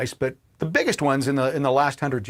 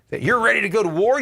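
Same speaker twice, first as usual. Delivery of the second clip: ordinary delivery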